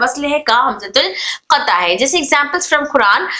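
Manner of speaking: usual speaking voice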